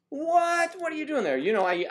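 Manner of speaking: a caught-out gasp turned into a high, loud squeak; shrill mock surprise. Mocking a surprised onlooker.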